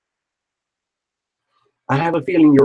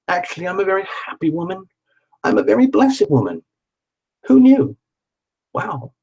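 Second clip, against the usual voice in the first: higher and faster, building to a delighted squeal on who knew; excited affirmation; 7 semitones higher than usual. Shown as a demonstration. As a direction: talk yourself into giddy joy, then a breathless wow. Playful affirmation getting carried away.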